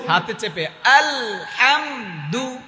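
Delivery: আলহামদু drawn out slowly, each sound held; slow recitation demo. Shown as a demonstration. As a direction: recite হাতে চেপে আলহামদু slowly for students to copy. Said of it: "আবার?". (ধীরে টেনে আলহামদু উচ্চারণ)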